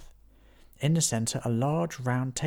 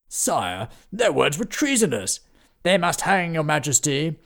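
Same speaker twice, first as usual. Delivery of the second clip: louder, higher outrage; indignant demand; 6 semitones higher, 8 dB louder than usual. Acted. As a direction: insist they hang. Knights demand punishment.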